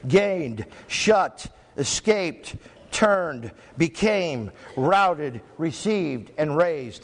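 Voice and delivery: each word set apart by a pause; slow, spaced roll call of words; word-by-word list reading. Shown as a demonstration. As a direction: read out each word singly, with a falling tune. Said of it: "let me read those words to you".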